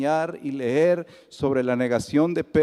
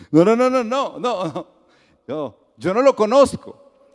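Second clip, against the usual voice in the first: higher, louder frantic no no no; loud panicked denial; 7 semitones higher, 6 dB louder than usual. Retold or imitated. Pedro denies knowing Jesus to the servant, loud and frantic.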